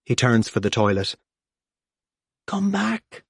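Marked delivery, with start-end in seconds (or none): 2.5-3.2 high pleading cry from inside, kept soft; a heart shrieking after him, high but hushed; inner shriek. A narrator's reading. P J's heart shrieks at Grandad to come back. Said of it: "shrieks P J's heart".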